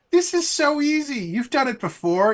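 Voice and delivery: sweet upbeat encouragement from behind; little voice of positivity. Acted. Fubari cheering from behind the rider, high cheery voice. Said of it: "this little voice of positivity behind you going".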